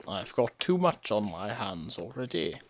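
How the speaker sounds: a light put-on British accent. Refusing the Netherlands' request, lightly British-accented. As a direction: slip into a hint of posh British. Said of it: "why the heck am I talking British".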